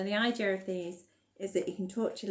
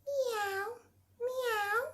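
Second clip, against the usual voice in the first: high soft mewing meow; cat noise; 13 semitones higher, 3 dB quieter than usual. Pretend. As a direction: mew like a kitten. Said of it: "making the animal noises".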